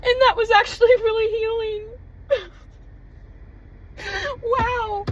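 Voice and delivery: high, crying through the words; real tears, voice lifting and breaking; tearful. Real, not acted. Crying after healing moment.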